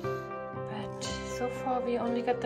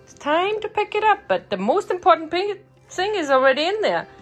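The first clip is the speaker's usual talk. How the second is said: high, loud sing-song for dogs; excited pet talk; 8 semitones higher, 6 dB louder than usual. Real, not acted. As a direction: announce it to the puppy with building excitement.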